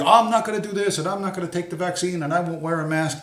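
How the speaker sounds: mock whiny defiance; mocking online tough guy. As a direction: parody the keyboard warrior. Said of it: "But you".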